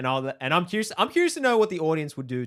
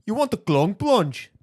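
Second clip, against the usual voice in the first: high, slow, hammed-up Scottish accent; 7 semitones higher, 4 dB louder, slower than usual. Podcaster trying another phrase on co-host, Scottish accent. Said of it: "do a Scottish accent".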